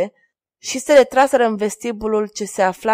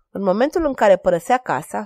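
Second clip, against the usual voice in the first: ordinary delivery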